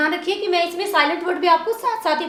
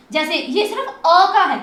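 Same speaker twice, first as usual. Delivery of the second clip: ordinary delivery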